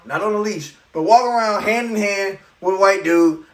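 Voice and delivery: usual speaking voice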